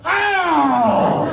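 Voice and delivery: a sharp cry as if catching a bullet in the teeth; short pained shout "Ah!". Retold or imitated. Comic catching a mime bullet: short pained shout. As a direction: yelp "Ah!" when the pretend bullet hits. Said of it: "I went".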